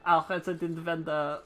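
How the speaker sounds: put-on French-accented advice; bad French accent. Ishgardian instructor advising on greens supply, bad French accent. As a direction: keep up the clumsy French accent. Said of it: "I'm so bad at this fucking accent".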